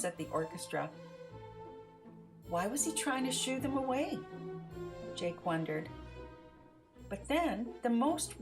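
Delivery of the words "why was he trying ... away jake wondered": ordinary delivery, nothing marked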